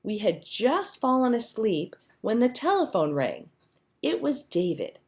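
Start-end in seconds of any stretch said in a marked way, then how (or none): none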